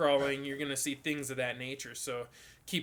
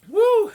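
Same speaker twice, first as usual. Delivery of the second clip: loud high whoop; excited whoop; 16 dB louder than usual. Real, not acted. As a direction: let out a loud cheer. Sudden excited cheer.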